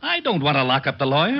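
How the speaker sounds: flustered. Acted. Confused back-and-forth about the lawyer.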